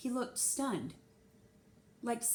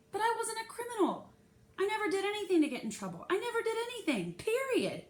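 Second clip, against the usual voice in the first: much higher, rushed insistence; panicked denial; 12 semitones higher than usual. A narrator's reading. Girl insists to herself she is no criminal, high and rushed. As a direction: insist on your innocence in a panic.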